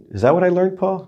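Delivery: confused student. Retold or imitated. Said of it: "You're like".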